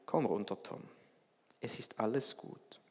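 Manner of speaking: tiefer, leiser König, ganz ruhig; tiefe, ruhige Stimme. A narrator's reading. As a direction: beruhigend zum Kind hinunter sprechen. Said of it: "eine tiefe Stimme sagte ruhig". (der König ruft Tom ruhig vom Baum herunter, tief und sanft)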